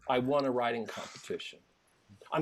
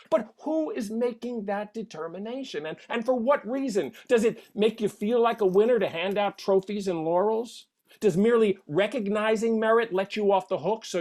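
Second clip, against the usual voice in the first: high, loud, insistent questioning; worked-up rhetorical grilling; indignant challenge; 8 semitones higher, 6 dB louder than usual. Acted. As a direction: fire the questions at the judges, pitch raised. Challenges judges of competitions.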